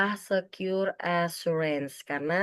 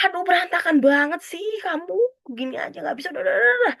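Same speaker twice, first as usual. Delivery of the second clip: put-on high, fed-up scolding of a messy child; exasperated scolding; 12 semitones higher, 4 dB louder, faster than usual. Mother scolding her child over a mess, high and exasperated. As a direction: nag the child sharply, high and impatient.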